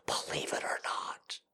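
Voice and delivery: slow hushed aside; whispered confidential aside. Real, not acted. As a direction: drop to a whisper.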